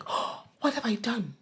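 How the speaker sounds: hushed, acted regret; soft put-on remorse; mock regret. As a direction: play someone quietly realising their mistake. Voicing someone's regret after kissing.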